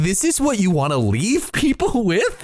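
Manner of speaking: high, disbelieving question with a laugh; incredulous. Retold or imitated. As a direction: ask it like you can't believe the editors. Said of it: "And I'm like".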